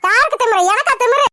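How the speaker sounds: challenging him, voice rising; indignant. Acted. Wife confronting husband over his insult, indignant. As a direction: demand who he meant. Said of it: "இப்ப எதுக்கு நீ கத்துற".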